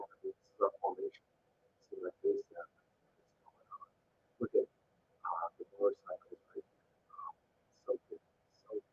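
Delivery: soft, breathy near-whisper, slowing down; hushed murmur. Real, not acted. Watching march footage with viewers, in a hushed murmur. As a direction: drop to a hush as if not to disturb the footage. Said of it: "I don't know why I'm talking like this".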